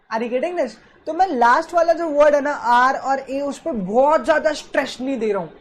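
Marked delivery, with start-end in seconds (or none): none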